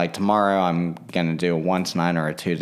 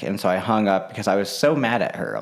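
ordinary talk, nothing marked

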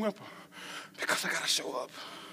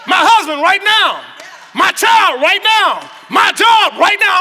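louder, higher, slow shouted claims; preaching shout; 9 semitones higher, 15 dB louder, slower than usual. Acted. Preacher claiming blessings aloud, shouting. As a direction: shout each claim over the church.

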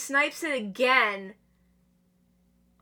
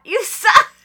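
high, loud jeer; taunting rival's loud jab; mocking shout; 10 semitones higher, 12 dB louder than usual. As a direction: yell the insult in scorn, much higher and louder. Mocking rival's ricochet shot.

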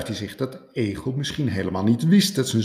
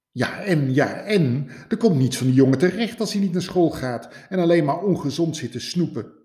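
higher, fast indignant sputter; outraged; 7 semitones higher, 4 dB louder than usual. Acted. Rabbit outraged at hedgehog's indifference, high and fast. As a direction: sputter back in disbelief.

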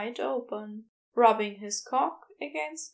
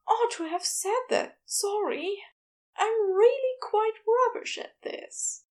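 high, apologetic character voice; sheepish self-reproach, pitched high; flustered Aziraphale; 10 semitones higher than usual. Acted. Flustered apology after the gasped confession.

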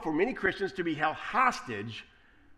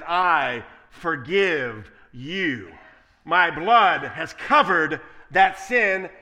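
slow, booming and much louder; voice of God; 9 dB louder, much slower than usual. Acted. Preacher voicing God forgiving believers, slow booming proclamation. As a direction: proclaim forgiveness slowly and loudly.

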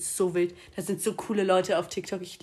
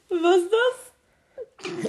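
squeaky, slow words through real laughter; barely gets it out for laughing; 9 semitones higher, 7 dB louder, much slower than usual. Real, not acted. Laughing at her co-host's joke.

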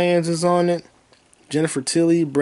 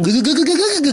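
comic stutter; 12 semitones higher, 4 dB louder than usual. Pretend. Stuttering punchline, pitched high.

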